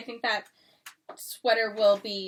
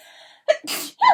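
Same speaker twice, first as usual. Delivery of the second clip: sneezing; 11 dB louder than usual. Real, not acted. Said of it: "Sorry, I'm gonna sneeze".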